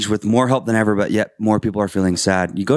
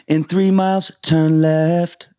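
high, far put-on sat-nav voice; mock the app's boy band accent; 6 semitones higher, slower than usual. Waze app giving directions, imitated boy band voice. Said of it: "a British accent or a boy band accent".

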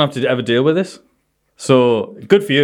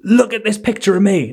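higher, insistent woman's voice; imitating eager woman; 9 semitones higher than usual. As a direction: push the photo on him, voice raised. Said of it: "And she went".

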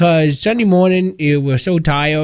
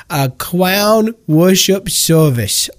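awed slow; much slower than usual. Acted. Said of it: "this just sends chills down my spine".

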